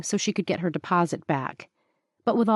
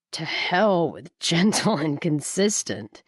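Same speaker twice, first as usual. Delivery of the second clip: narrator's Booker waving away her words; Booker, scoffing; slower than usual. A narrator's reading. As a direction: drag out the contempt lightly.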